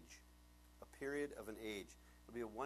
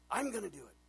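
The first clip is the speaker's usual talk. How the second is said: lightly imitated child insisting, pitched up; stubborn little girl; 6 semitones higher, 4 dB louder than usual. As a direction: insist like a small kid. Dad voices stubborn four-year-old daughter.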